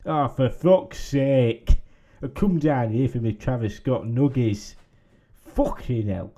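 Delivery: slow, exasperated groan of disappointment; exasperated customer. Acted. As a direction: huff it out, crushed and annoyed. Skit customer frustrated and swearing.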